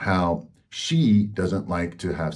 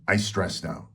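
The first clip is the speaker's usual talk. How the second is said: ordinary delivery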